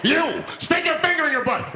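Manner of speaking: very high, forceful order; barked demand. Acted. Man terrorizing passengers, high yelled demand. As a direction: yell the order at a chosen victim. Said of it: "he starts making demands".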